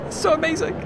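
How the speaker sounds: high, soft, mock-tearful gush; half-joking choked-up voice. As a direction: pretend to be overcome, lightly. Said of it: "I'm choking up".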